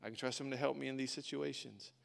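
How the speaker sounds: quiet trust. Retold or imitated. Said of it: "then you're gonna be like".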